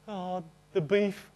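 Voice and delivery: slow, teary mumble of an answer; crying like a big girl. Retold or imitated. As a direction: choke out the words through tears. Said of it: "like a big girl".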